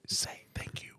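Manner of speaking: soft whispered prompt; hushed, breath-only voice; whispering. Real, not acted. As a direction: whisper it to the person beside you. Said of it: "We're whispering".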